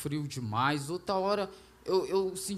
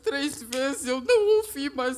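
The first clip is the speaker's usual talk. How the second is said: real tears breaking through the words; tearful, slowed and strained; crying; 6 semitones higher, 5 dB louder than usual. Real, not acted. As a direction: voice breaking into tears, higher and louder. Testimony climax, crying heard.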